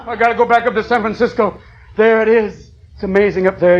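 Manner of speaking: ordinary talk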